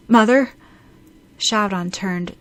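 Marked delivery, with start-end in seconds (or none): 0.1-0.5 louder, higher call down the phone; anxious call. A narrator's reading. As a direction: call out with rising worry. Calls again, more urgently.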